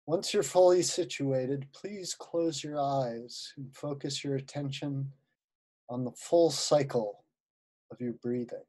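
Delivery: unhurried guided-visualization voice with long pauses; guided meditation. Acted. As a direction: ease listeners into relaxation, phrase by phrase.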